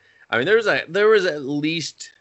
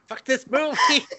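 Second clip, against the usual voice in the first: high-pitched, laughing complaint; half-laughing comic griping; laughing mock rant; 8 semitones higher than usual. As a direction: play up the exasperation through laughter.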